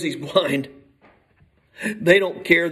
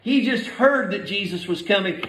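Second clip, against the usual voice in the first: usual speaking voice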